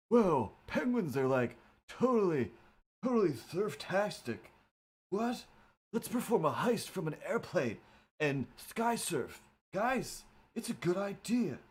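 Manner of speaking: a chilled-out surfing penguin; surfer dude voice. Pretend. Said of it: "not a good idea or a good voice".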